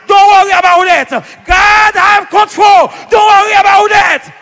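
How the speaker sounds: high, loud, insistent delivery; shouted reassurance. Retold or imitated. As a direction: shout the comfort with preacher's force. Retold reassurance built to a shout.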